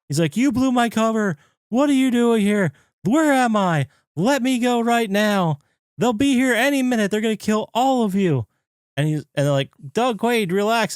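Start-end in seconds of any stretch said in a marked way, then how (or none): 0.4-8.5 high-pitched frantic mock panic; imitated freak-out. Doug raging at the lab staff, mock freak-out. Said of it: "Doug is freaking out". 9.9-11.0 high-pitched mock plea; retold urgent pleading. Lab staff trying to settle Doug, high mock urgency.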